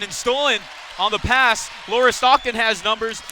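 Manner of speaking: excited fast-break call. Real, not acted. Commentator calls Townsend going up after a steal, excitedly. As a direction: build from the steal to the shot.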